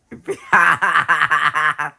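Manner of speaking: staccato peal of laughter; acted laugh. Prankster laughing at the disgusted reaction, acted laugh. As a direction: laugh on in even bursts.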